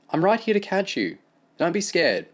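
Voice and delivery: put-on Amy reassuring a scared boy; reassuring girl. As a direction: promise him you'll catch him.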